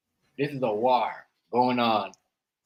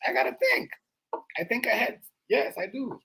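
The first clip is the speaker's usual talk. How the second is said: high insistent; 12 semitones higher, 4 dB quieter than usual. Real, not acted. Insisting he thinks ahead.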